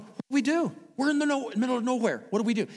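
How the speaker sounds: stranded travelers fretting about the storm, mock panic.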